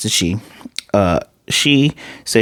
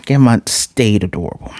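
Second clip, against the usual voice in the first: ordinary talk, nothing marked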